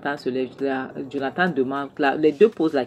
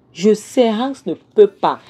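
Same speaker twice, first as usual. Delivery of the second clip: higher and louder, distraught; louder, higher panicked protest; distressed cry; 6 semitones higher, 7 dB louder, slower than usual. Acted. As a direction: cry it out as she realizes her mistake. Mother's distressed realization, acted.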